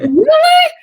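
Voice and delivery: put-on high, loud, laughing squeal of a thrilled kid; hammed-up little boy who can't believe it; son's delighted disbelief. Mother retelling son's reaction to cake news, thrilled squeal. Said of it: "And he's like".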